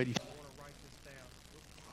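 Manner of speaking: faint, as if the microphone died; mic cutting out. Real, not acted. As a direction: voice dropping away off mic. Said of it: "Lost, lost".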